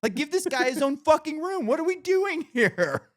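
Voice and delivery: high, loud, exasperated; outraged. Retold or imitated. Worker to the foreman about the roommate, outraged. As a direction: push it up into outrage. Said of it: "I'm like".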